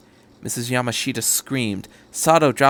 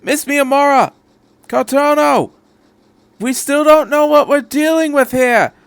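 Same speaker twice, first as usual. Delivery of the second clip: much higher, louder call across the car; 7 dB louder than usual. A narrator's reading. Doctor calls after Miss Miyamura, loud warning shout. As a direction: shout her name to stop her. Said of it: "called out".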